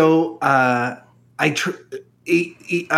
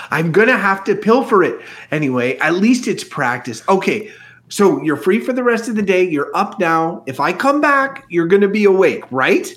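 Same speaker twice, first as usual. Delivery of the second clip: put-on high young voice; 3 semitones higher than usual. Flea's voice.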